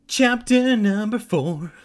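high, loud heading announcement; big theatrical chapter call; shouted chapter announcement. A narrator's reading. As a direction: bellow it like a dramatic announcer. Reader bellows the chapter heading.

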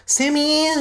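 high drawn whine of Sammy; whining. Retold or imitated. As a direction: whine the name like a kid. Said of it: "Simon whined".

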